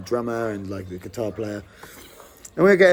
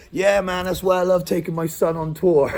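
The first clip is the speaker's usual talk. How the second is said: laughing rocker; 7 semitones higher, 5 dB louder than usual. Retold or imitated. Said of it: "And he was like".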